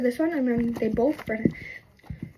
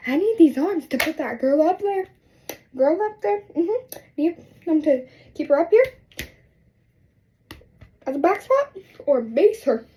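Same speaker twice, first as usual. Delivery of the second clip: raised, louder insistent protest; retold indignant protest; 5 semitones higher, 5 dB louder than usual. Cheerleader refusing to let dad break her arm, indignant. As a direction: push back hard, higher and louder.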